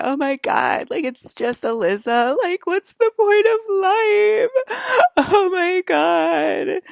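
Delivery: fake-sob over the pointlessness of life; mock crying. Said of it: "crying today".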